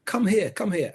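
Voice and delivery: high-pitched call across a busy room, mildly mocked; retold shout across the room. Actress calls director across room, lightly imitated high shout. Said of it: "she shouted across the room".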